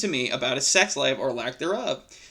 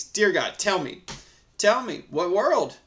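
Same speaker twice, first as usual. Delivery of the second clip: ordinary delivery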